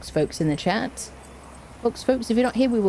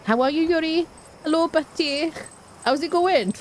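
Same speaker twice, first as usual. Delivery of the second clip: lightly Welsh, sing-song and higher greeting; Welsh accent; 9 semitones higher than usual. Pretend. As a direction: greet a friend in a playful Welsh lilt. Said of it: "I haven't done a Welsh accent in ages".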